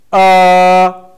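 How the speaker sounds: long, level "uh". Shown as a demonstration. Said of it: "from the first tone".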